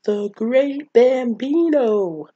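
loud, slow, grand proclamation; Ham. Retold or imitated. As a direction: announce it high and drawn out, like a legend's title. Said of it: "as Ham would say".

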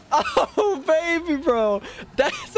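high squeal of laughter; laughing out the words. Real, not acted. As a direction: crack up at the teammate's blunder. Laughing at a teammate's blunder.

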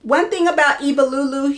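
normal talking voice

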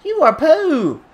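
put-on slow, very high, childish whine; hammed-up baby-voice insult; mock whiny taunt. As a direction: taunt the referee like a sulky toddler.

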